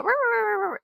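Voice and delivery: high run of mock barks; imitated dog barking. Dogs running the fence line, imitated barking. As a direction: yap like dogs along a fence. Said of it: "they do their bark thing".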